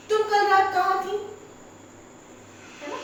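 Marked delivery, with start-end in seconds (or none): 0.1-1.2 sharp, high, loud accusing demand; suspicious partner demanding an answer; accusing question. Shown as a demonstration. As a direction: fire the question at her, fast and high. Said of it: "जैसे मैं अगर बोलूं".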